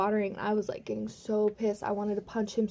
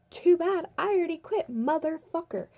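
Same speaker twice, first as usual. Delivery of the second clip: raised pitch, acted-out defiance; defiant retort; 7 semitones higher than usual. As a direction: throw it back at the boss, high and punchy. Own retort in acted quarrel.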